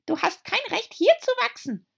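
very high tiny squeak; squeaky little dormouse. A narrator's reading. Dormouse scolds.

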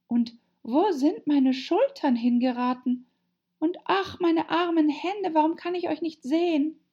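high, plaintive Alice voice; plaintive lament. Acted. Alice calling to her vanished hands, plaintive. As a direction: wail it mildly, pitched well up.

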